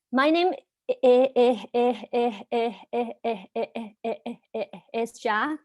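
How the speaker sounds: repeats the first sound many times; stuttering. Real, not acted. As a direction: gets stuck on i- before the word. Speaker who stutters introduces herself.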